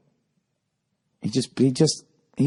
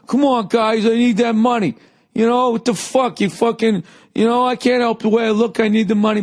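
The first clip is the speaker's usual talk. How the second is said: pleading yell; 10 semitones higher than usual. Retold or imitated. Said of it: "He's like".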